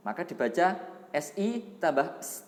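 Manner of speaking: usual speaking voice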